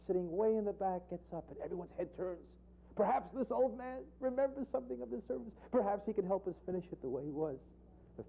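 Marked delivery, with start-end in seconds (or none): none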